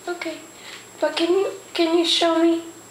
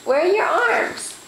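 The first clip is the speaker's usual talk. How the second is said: higher sing-song repeat; 7 semitones higher than usual. Real, not acted. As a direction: lift the question much higher, coaxing. Parent asks toddler, then repeats.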